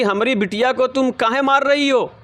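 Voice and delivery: mother defending her daughter against the neighbour; mother confronting neighbour. Retold or imitated. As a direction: demand it of the neighbour, pitch up. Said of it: "वो कहती हैं कि".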